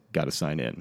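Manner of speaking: low, gruff guard's order; security guard voice. Retold or imitated. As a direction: drop low and bark it like a bored guard. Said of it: "and he says".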